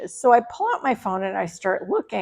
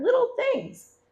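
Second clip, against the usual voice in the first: squeaky, much higher little voice on "little things"; cute high voice; 14 semitones higher than usual. Pretend. Telling viewers about her small purchases, in a tiny high voice.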